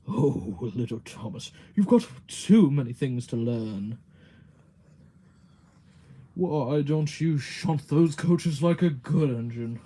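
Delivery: pompous big-engine character voice, booming in places; Gordon. Shown as a demonstration. Streamer demonstrating Gordon scolding little Thomas, booming put-on voice. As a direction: put on a haughty engine lecturing a little one. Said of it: "here's my Gordon".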